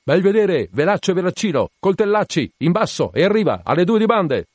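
high, loud ship's commands; shouted orders. A narrator's reading. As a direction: fire off sail orders across the deck. Captain shouting sail orders to crew.

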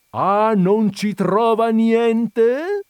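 high, loud, drawn-out outrage; ranting. A narrator's reading. Visitor ranting at the puzzled countess, outraged. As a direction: bellow it back in disbelief. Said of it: "strepitò l'altro".

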